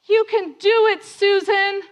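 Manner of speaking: high, loud cheer from the sidelines; cheering spectator. Retold or imitated. Lady on the side to flagging runner, loud high cheer.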